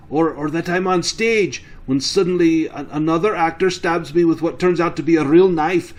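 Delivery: worked-up, emphatic farmer; agitated farmer recounting nightmares. Acted. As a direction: press each dreadful detail on the listener. Farmer continues his nightmares to innkeeper.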